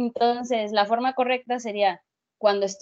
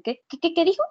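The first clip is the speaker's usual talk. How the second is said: higher-pitched baffled mock question; mock confusion; 7 semitones higher, faster than usual. Student imitating a listener stunned by cacophony, mock confusion. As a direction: act out a dazed listener, rising in pitch. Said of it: "te quedas así como que".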